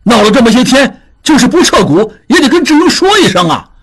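音调很高的着急大喊; 冲着走开的人急喊; 急了眼. A narrator's reading. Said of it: "达庚急了眼". (达庚冲扬长而去的达庆急得高喊)

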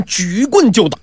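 ordinary delivery, nothing marked

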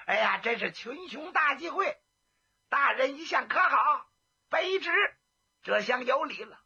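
hearty greeting. Acted. Jiang Ping cheerfully greets the magistrate and heroes, excited. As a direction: hail the crowd with exaggerated cheer.

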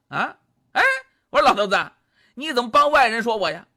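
plain reading voice

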